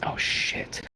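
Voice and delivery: unvoiced, low hush of dismay; whispered oh shit. Realizing he blew his cover, a whispered curse. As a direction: breathe it out in alarm.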